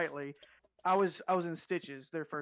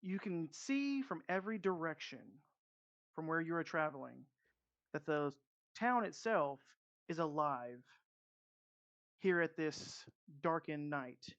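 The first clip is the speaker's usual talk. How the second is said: game master scene setting; 3 dB quieter than usual. Acted. DM opening the first session for the players, ominous scene narration. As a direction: draw the players into the burning town.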